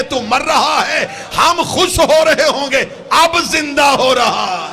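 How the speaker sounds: high, loud sermon climax, nearly shouted; full-force preaching. Real, not acted. Preacher tells the crowd of birth and death, shouted climax. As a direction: build to a ringing shout for the whole hall.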